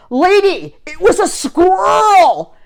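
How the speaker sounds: hammed-up road-rage shout from an angry driver; mock driver yell. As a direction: bellow it out the truck window at her. Angry truck driver yelling from window.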